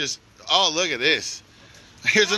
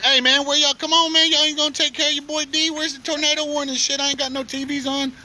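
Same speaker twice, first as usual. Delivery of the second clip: yelling out; 8 semitones higher than usual. Real, not acted. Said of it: "I'm yelling".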